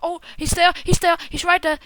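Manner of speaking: urgent repeated warning; alarmed callout. Real, not acted. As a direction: call out the enemy in a panic. Spots enemy in game.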